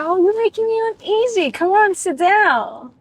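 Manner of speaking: mock pleading, stretched out and high; coaxing complaint. Man coaxes her to sit down, mock wheedling.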